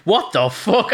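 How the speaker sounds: startled outburst, high and loud. Retold or imitated. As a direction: blurt it out as if you just jumped. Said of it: "I kinda just jumped".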